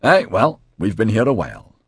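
lightly voiced Scottish accent; a hint of a broad Scottish accent. A narrator's reading. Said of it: "in a broad Scottish accent".

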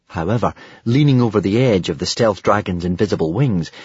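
usual speaking voice

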